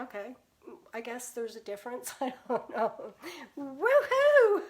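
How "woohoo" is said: much higher, louder joyful cheer; excited whoop. Real, not acted. Painter cheers to her livestream viewers, excited whoop. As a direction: let out a big happy woohoo.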